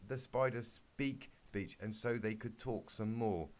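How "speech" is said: usual speaking voice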